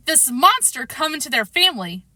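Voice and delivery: usual speaking voice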